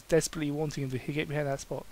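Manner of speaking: ordinary delivery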